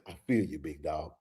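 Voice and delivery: soft, low, consoling voice to a fan; soft reassuring words to a disappointed fan; consoling buddy. Retold or imitated. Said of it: "I put my arm around you and say".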